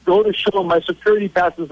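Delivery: normal talking voice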